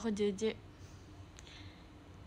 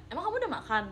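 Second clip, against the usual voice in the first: flat plain question; 7 semitones higher, much faster than usual. Shown as a demonstration. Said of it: "nadanya kalau kayak gitu".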